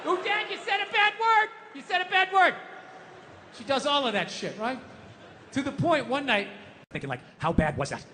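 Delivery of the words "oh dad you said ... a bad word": higher, lightly voiced little girl telling on her dad; little daughter. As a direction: play a small child pointing out the bad word.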